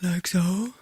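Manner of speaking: breathy, whispered low intake. Shown as a demonstration. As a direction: breathe in with the larynx down. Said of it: "I can have a deeper sound".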